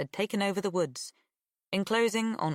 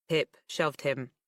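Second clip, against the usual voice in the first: ordinary delivery, nothing marked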